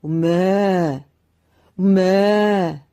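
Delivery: slow, drawn-out loud mooing; a man trapped in a cow's body, mooing. A narrator's reading. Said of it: "소의 울음소리에 지나지 않았습니다".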